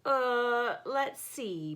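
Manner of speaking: drowsy, mid-yawn. Acted. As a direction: stretch the words through a yawn. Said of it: "Bear said with a yawn".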